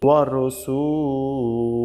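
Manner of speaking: long drawn-out syllables, measured recitation; slow Quranic recitation. Shown as a demonstration. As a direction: recite it slowly, stretching every long vowel. (ধীরে টেনে টেনে তিলাওয়াত)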